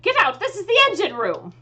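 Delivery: indignant yell at an intruder; angry shout. Acted. Elf mage orders a stranger out of the engine room, angry shout. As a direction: bark it out, high and loud.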